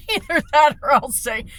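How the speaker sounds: ordinary talk, nothing marked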